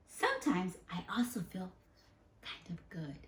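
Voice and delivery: soft whisper, fading away; hushed, shy admission; whispered confession. Acted. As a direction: confide it quietly. Reads feeling good softly.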